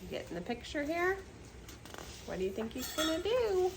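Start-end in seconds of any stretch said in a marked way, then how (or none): none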